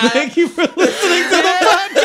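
high-pitched, helpless laughter; laughing sign-off. Real, not acted. Host thanking podcast listeners, cracking up. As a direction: squeeze the thanks out through laughs.

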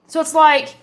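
normal talking voice

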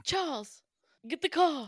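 loud high posh command; bossy mock aristocrat; posh lady voice. Plays posh madam.

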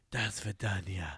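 low, soft, drawn-out melodrama; old-movie tragic goodbye, hushed and slow; dramatic farewell. Retold or imitated. Host mimicking Victor's emotional goodbye to his coach, dramatic. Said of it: "It's so dramatic".

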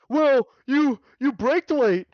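lightly voiced pissed-off pedestrian scolding the driver; imitating the pedestrian. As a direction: driver mimics the man who scolded him. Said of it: "he goes".

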